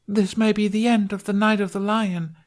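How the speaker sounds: high, loud dramatic wail of a line; theatrical lament. A narrator's reading. Fallen knight laments to the audience, high and loud. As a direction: cry out as the fallen stage hero. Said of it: "she lamented".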